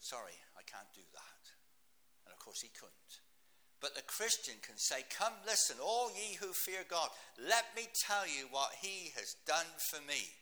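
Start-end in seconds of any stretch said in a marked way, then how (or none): 0.0-1.4 a soft, breathy whisper of defeat; whispered admission. Retold or imitated. The atheist admitting defeat to the preacher in a whisper. 5.0-10.3 loud, slow and high, calling to the crowd; preaching at full force. Acted.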